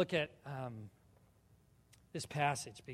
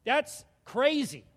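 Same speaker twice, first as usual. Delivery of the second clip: amazed; 10 semitones higher, 11 dB louder, much slower than usual. Real, not acted. Reacting to the law.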